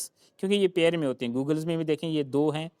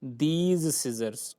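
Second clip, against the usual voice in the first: my scissors said slowly, word by word; slow teaching read; much slower than usual. Shown as a demonstration. Teacher slowly dictating an example to students. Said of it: "वहाँ पर सही क्या होगा".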